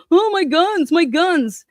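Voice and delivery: high whiny mock wail of "Oh, my guns"; mock a whiny Idaho gun owner. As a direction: whine like a spoiled child clutching his guns. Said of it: "You guys are so".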